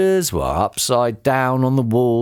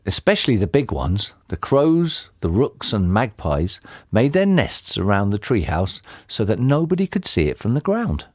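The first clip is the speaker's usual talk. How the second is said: usual speaking voice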